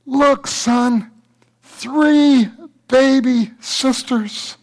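put-on high, very slow "three baby sisters"; proud father reveal. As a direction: drag out the surprise. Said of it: "turned the blanket again".

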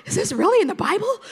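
high, loud, fast mock disbelief; shocked disbelief. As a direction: act scandalised at what you just read. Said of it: "you're kinda, like, going".

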